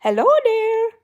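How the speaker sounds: high squeaky loud greeting; voicing the stitch. Crocheter voices a stitch greeting her, squeaky high call. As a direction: give the stitch a tiny eager voice calling out. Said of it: "It's like".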